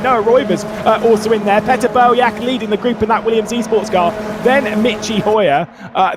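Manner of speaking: lively live race call; motorsport commentator. Acted. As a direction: call the chaos in the pack.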